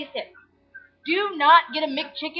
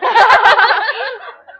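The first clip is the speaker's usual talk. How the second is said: big helpless laugh; loud burst of laughter; 7 semitones higher, 8 dB louder than usual. Real, not acted. Friend cracking up at her own joke, loud laugh. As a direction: crack up loudly. Laughing fit over itch.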